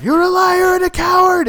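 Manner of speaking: high, loud furious shout; high loud angry movie yell; imitated yell. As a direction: scream it back like a betrayed apprentice. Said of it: "Anakin yells out".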